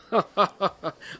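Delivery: high, acted chuckle; father's laugh. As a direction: laugh fondly as the dad. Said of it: "پدر لبخندی زد و گفت". (خندهٔ پدرانه)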